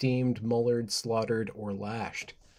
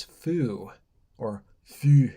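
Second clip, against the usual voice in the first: put-on Scottish accent; 4 dB louder, much slower than usual. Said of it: "that's not enough for an accent".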